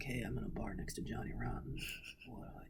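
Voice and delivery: whispered. Retold or imitated. Quoting own text message.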